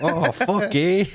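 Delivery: high, laughing Canadian eh; mock Canadian accent. Joining friend's Canadian bit, laughing mock accent. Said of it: "do a Canadian".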